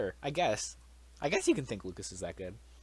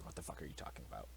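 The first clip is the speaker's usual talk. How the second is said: voicing Bowser Junior in a low, much softer whisper; whispered Bowser Junior quote; 9 semitones lower, 15 dB quieter, faster than usual. Giving Bowser Junior's mock reaction, low hushed whisper. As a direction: hush it right down as a mock character line. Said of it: "Bowser Junior".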